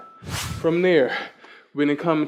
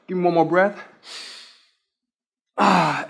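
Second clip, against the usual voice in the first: ordinary talk, nothing marked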